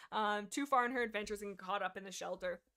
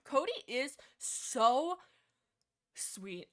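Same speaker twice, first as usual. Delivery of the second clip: very slow, drawn-out, gushing emphasis on each word; slow adoring gush; 4 semitones higher, 5 dB louder, much slower than usual. Shown as a demonstration. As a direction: savour every word like a fan in love. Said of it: "repeats it drawn out".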